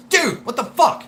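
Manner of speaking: mock exasperated shout; retold yell. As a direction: yell at the messy boss. Retold yelling at boss.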